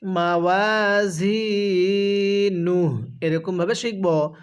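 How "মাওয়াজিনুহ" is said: drawn-out recited মাওয়াজিনুহ, falling at the end; slow tilawat. Shown as a demonstration. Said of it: "কিভাবে শিখব?". (টেনে টেনে তিলাওয়াত)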